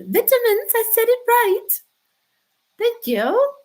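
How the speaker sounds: high and bright, VIT-a-mins with a short i; vitamins said high with a short i, pleased; proud British vitamins. Shown as a demonstration. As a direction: show off the right pronunciation, squeaky high. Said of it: "Perfect pronunciation".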